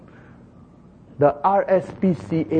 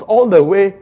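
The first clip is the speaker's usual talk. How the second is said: usual speaking voice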